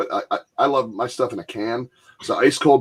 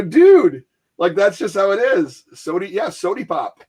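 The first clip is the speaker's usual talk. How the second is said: exasperated explaining; 7 semitones higher, 3 dB louder than usual. Retold or imitated. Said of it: "I go".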